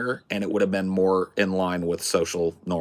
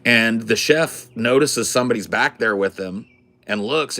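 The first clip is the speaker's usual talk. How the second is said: ordinary delivery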